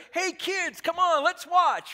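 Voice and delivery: put-on loud, high, excited call to the kids; eager call. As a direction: summon the family to the show. Calling kids to watch a fight.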